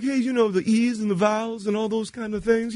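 high singer's talk. Retold or imitated. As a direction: go up high and musical. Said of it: "he's kind of like".

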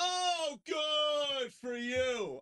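high, drawn-out mock British shout. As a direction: holler it slowly, with sarcastic British outrage.

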